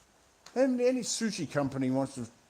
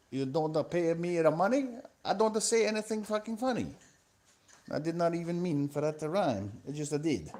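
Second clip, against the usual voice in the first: passable mock Italian accent, raised pitch; hammy Italian accent, higher voice; 4 semitones higher than usual. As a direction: put on a comic Italian accent. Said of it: "you slipped out of the Italian accent".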